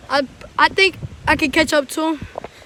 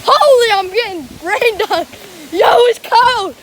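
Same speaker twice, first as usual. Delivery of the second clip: higher, louder yelling; rain yell; 5 semitones higher, 6 dB louder than usual. Real, not acted. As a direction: yell through the cold downpour.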